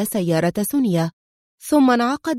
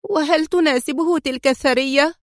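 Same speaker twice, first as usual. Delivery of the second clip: higher bitter question; bitter outcry; 6 semitones higher than usual. A narrator's reading. Mariana challenging her father about the rich wife, bitter. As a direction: fling it out with bitterness. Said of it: "هتفت في مرارة".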